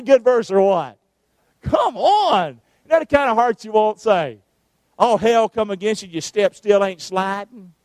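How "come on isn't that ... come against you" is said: normal talking voice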